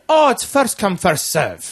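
put-on lightly accented, high start on oh; a hint of a nondescript European accent; vague European accent. Quoting the sellers in a vague European accent. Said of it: "they had an accent".

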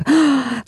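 sharp, high gasp of delight. Retold or imitated. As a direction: gasp like a smitten cartoon character. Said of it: "I was like".

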